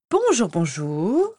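slow, thin, sugary greeting; thin little voice. Acted. Rita Skeeter greets the group in a thin voice. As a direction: coo it like a fake-sweet journalist. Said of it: "d'une voix fluette".

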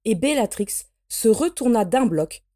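ordinary delivery

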